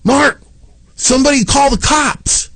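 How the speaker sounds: high, urgent cry for help over the street; panicked neighbor calling. Retold or imitated. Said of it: "Eric looks at me and goes".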